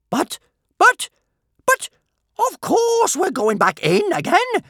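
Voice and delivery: very high, loud, spluttered protest; horrified spluttering. A narrator's reading. Bertha protesting the judge's ruling, horrified splutter. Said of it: "spluttered Big Boobied Bertha in horror".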